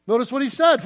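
ordinary talk, nothing marked

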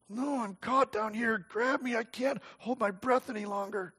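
hushed panicked begging; breathless plea. A narrator's reading. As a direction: beg as if the air is gone. Drowning girl begs for help.

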